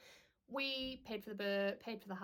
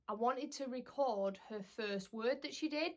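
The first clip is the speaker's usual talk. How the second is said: ordinary talk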